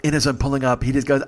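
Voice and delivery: ordinary delivery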